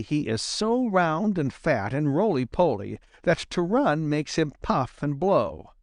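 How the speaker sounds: ordinary delivery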